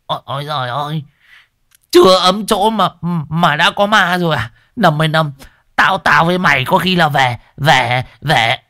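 high, stammering fright; panicked shriek. Acted. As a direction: yelp in panic at the ghost. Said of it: "Đồng già nó rú lên". (rú lên hoảng sợ, lắp bắp)

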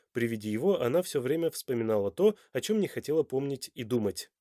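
usual speaking voice

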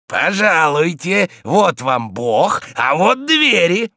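slow, loud, drawn-out invitation to leave; servant's insistent send-off. Acted. Zakhar points guest to the door, insistent send-off. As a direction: stretch each phrase, ushering him out firmly.

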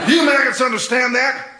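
drill sergeant. Acted.

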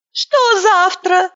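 high, teary, slow phone voice; a weepy woman picking up the phone; tearful high woman. A narrator's reading.

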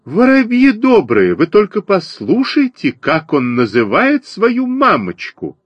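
very high, outraged appeal to the flock; screeching sparrow. A narrator's reading. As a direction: wail it high to every sparrow around. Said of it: "заверещала воробьиха". (высокий возмущённый вереск воробьихи)

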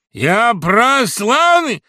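громкий высокий медленный выкрик; провозгласи с вызовом; гордый выкрик. Acted. Гундосый гордо кричит. (громкое гордое заявление)

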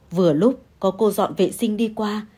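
plain reading voice

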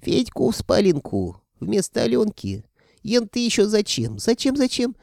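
very high second voice, querying; drawling self-talk. A narrator's reading. Sorcerer suddenly bickering with himself over the bedroom, drawled puzzlement. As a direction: argue with yourself in a high character voice. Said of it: "будто разговаривая сам с собой, протянул".

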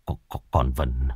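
halting, stunned stutter; stammering. A narrator's reading. Father tells his wife their child died, stammering. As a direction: break it word by word, in shock. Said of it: "lắp bắp từng câu từng chữ".

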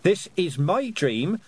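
high, emphatic, drawn-out demands; defiant yelling. A narrator's reading. Girl ordering a towering whale about, high defiant yell. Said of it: "yelled at the towering whale".

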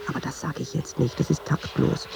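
half-whispered, almost voiceless aside; a tactless thought kept under the breath; hushed aside. Acted. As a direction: drop to a near whisper as if it shouldn't be said. Tactless thought held back, aside.

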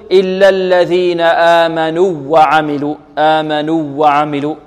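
آمنوا وعملوا with the two waws merged into one doubled w; wrongly merged waws. Shown as a demonstration. Teacher shows students' mistake, merged waw. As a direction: cut the long oo of آمنوا into a doubled w. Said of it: "لا نقول".

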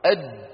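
أد with the tongue held on the د, no release; held stopped dal. Shown as a demonstration. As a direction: trap the air on the د of أد. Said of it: "كذلك الدال". (أد بحبس الهواء عند الدال)

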